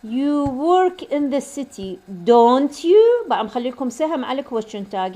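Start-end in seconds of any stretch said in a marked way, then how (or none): 0.0-3.3 slow statement, then "don't you" higher and louder; rising tag intonation. Shown as a demonstration. Teacher modelling for students, raised intonation on "don't you". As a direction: push "don't you" up loudly as a question. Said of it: "من تلفظوها لازم نغمة مرتفعة".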